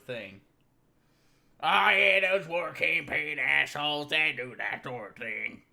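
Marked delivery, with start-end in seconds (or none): 1.6-5.6 loud, high, slow mocking imitation; loud sneering impression of a woman; mocking her voice. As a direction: parrot her words back with a sneer. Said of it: "That's her actual voice".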